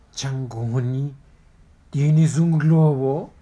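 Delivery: the heron calls out doubting; loud heron. Acted. Heron asks loudly.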